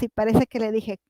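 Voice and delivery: usual speaking voice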